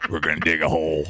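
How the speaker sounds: low, monotone mock digger; put-on company voice. Host plays the boring company, low put-on voice. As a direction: drop low and intone it like a tunnel borer. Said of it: "You gotta say it in that voice".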